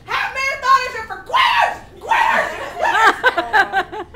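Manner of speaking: high, full-volume screamed heckle; screaming taunt. Retold or imitated. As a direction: scream it after the runners, comically outraged. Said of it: "I started screaming".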